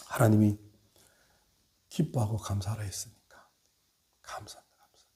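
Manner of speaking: breathy whisper of thanks, dying out; hushed whispered thanks to God; whispered prayer. Shown as a demonstration. Preacher acts whispered prayer.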